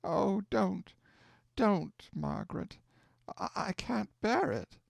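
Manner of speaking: grieving aunt, voice cracking; distressed pleading. Acted. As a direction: beg her to stop through tears. Hannah begs her to stop.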